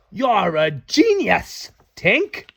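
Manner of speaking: a slow, loud "genius"; admiring Beck. Acted. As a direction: draw out the praise. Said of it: "Beck said".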